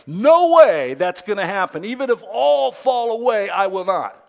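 full-voiced, high shouted declaration; Peter. Peter vowing before the gathering never to fall away, shouted declaration. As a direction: proclaim it so everybody hears. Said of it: "So he makes sure everybody knew that as he declares".

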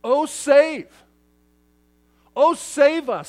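high, loud cry to be saved; loud plea. Retold or imitated. Preacher voicing the crowd crying for rescue, loud plea. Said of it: "Simply put".

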